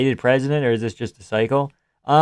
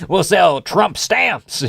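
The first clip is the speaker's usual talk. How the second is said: very slow, higher mock Texan pitch; Texan Trump impression; 6 semitones higher, much slower than usual. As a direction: stretch it out like a Texan salesman. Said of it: "why he became Texan".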